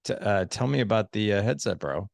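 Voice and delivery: hesitant, dubious question; unimpressed, halting bro tone; disapproving. Shown as a demonstration. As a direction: ask as if it looks crazy. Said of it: "why do you have that on your head".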